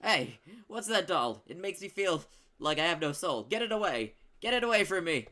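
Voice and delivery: loud, high furious shriek. Acted. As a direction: scream it in seared wrath. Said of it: "twisting into a mask of seared wrath".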